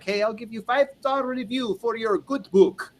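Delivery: high, put-on mix of Russian and Indian accent; hammed-up Russian-Indian accent; mock foreign accent. Mocking a paid Fiverr reviewer in a Russian-Indian accent. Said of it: "I have no idea what the accent was".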